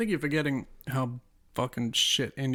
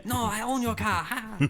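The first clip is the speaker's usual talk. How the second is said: much higher put-on Chinese accent, gloating; hammed-up Chinese-accented outback mechanic, mocking; 8 semitones higher than usual. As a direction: lord it over the stranded driver in a cartoon Chinese accent. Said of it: "Chinese automobile, like, mechanic guy".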